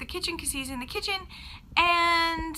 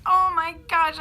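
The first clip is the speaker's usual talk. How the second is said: a louder mock yell, forced out on the breath; half-yell the alarm as you fall; retold yell; 3 semitones higher, 7 dB louder than usual. Tripping over the dog in the entryway, mock yell. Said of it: "I'm yelling".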